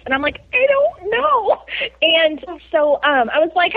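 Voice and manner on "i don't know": high, drawn-out helpless reply; retold cluelessness in a high voice; clueless reply. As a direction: stretch it out, pitched way up. Retelling her clueless reply to driver.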